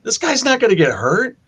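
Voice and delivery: scoffing, unimpressed; dismissive scoff. Retold or imitated. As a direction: wave it away like it's obvious. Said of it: "You're like".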